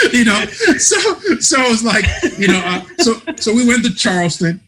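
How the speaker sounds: high, loud words through laughter; laughing. Real, not acted. Guest laughing his way back into the story, high and loud. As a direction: still giggling while trying to talk. Still laughing after the joke.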